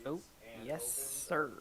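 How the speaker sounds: ordinary talk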